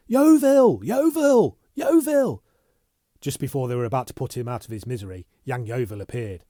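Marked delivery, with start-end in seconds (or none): 0.1-2.4 high, loud frantic yells; shouting. Acted. Bunny racing through the offices, yelling for Yeovil, high and loud. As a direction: yell the name like a panicked alarm. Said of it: "He continued his yelling".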